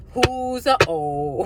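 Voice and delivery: high, stretched teasing question; slow taunt. Pretend. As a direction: drawl it out to mock.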